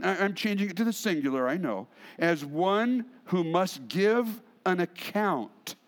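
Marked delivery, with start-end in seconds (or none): 2.2-5.8 slow, weighted scripture reading. Shown as a demonstration. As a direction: draw the verse out deliberately. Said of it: "let's read it again slowly".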